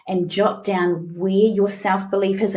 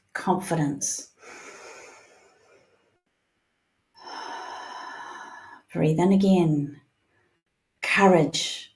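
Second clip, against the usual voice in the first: guided meditation. Acted. Coach guiding a group through breathing, soothing meditation voice. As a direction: ease the listeners into the feeling, gently.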